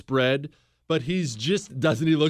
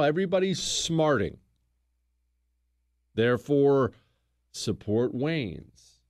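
ordinary talk, nothing marked

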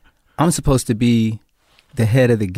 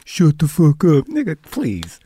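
higher, slow, drawn-out mimicry; mocking soft imitation; 5 semitones higher, slower than usual. Gang member mocking the actor's line as soft, mocking. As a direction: parrot it back in a wimpy singsong. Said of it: "You sound like a little bitch".

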